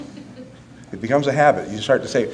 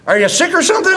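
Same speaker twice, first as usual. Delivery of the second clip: put-on high, loud baffled old father; gruff old dad; 13 semitones higher, 4 dB louder, faster than usual. Father asking his son if he is dying, high and loud. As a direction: sound suspicious and baffled. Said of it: "Here's his next line".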